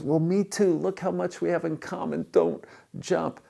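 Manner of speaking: high-pitched, eager enthusiasm; eager delight. Acted. Rescuer to a fellow Protestant, eager delight. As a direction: win him over with excited common ground.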